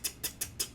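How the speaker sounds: soft, toneless tick, tick, tick; whispered ticks. Retold or imitated. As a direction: breathe the ticks out like a silenced gun. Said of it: "it sounds like tick".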